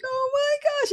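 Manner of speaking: crying with joy at finding the dog; overjoyed crying. Real, not acted. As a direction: let the relief break through in a high teary voice. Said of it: "I was crying".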